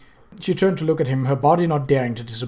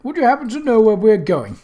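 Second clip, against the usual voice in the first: put-on light, eager young man; chatty nervous wizard; 6 semitones higher, 3 dB louder than usual.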